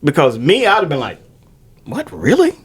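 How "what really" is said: high-pitched incredulous question; mock disbelief. Showing how he would answer her refusal, high mock disbelief. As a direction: act taken aback, pitch jumping up. Acting out challenge to a rejection.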